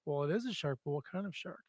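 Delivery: ordinary talk, nothing marked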